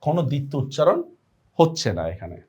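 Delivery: normal talking voice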